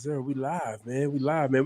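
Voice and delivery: normal talking voice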